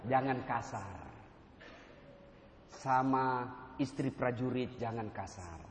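low, soft, slow admonition; nasihat lembut. Real, not acted. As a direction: counsel them gently against harshness. Gentle admonition against harshness. (menasihati dengan lembut dan pelan)

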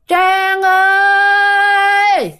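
high, long, sing-song call, stretched slowly; drawn-out ghost call. Retold or imitated. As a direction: draw the name out slow like a ghost calling from the dark. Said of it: "nghe tiếng kêu mà nó kéo dài ra như vậy". (tiếng ma gọi ngân dài, cao và chậm)

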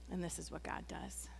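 hushed, unvoiced aside; whispered reveal. Real, not acted. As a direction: drop to a secret whisper. Hushed reveal of God's response.

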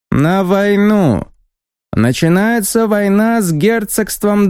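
high, slow, squeaky proclamation; haughty envoy declaring war. Acted. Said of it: "высоким, громким и совсем немного писклявым голосом".

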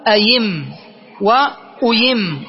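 أيم وأيم as ayimm, then uyimm; y glide replacing the second hamza in أيم وأيم; hamza turned ya. Shown as a demonstration. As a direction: demonstrate أيم وأيم with a drawn-out final m. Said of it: "فنقلبها ياء فنقول".

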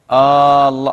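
loud held "aa" at the start of الله; wrong long opening hamza. Shown as a demonstration. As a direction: stretch the opening of الله into "aallah". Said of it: "غلط".

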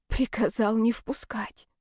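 slow breathless whisper; stunned whisper. Acted. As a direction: whisper the words in shock. Stunned repeating the words.

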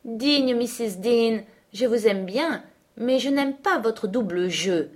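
ironic courtesy to the housekeeper; sneering aloud. Acted. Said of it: "ajouta-t-il tout haut". (ton moqueur, dit tout haut)

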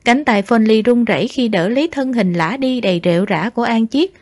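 plain reading voice

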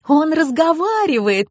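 high-pitched amazed delight. A narrator's reading. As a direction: marvel in a high girlish voice. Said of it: "пришла в восторг Амалия".

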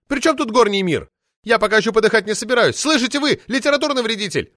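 put-on high, loud indignant shriek of an old party lady; shrill furious official lady yelling; roaring outrage. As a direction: howl it out like an enraged sabre-toothed tigress. Poetess scolds editor.

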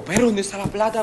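retold urgent question. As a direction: act out a panicky accomplice asking. El cómplice busca la plata. (pregunta urgente imitada, aguda)

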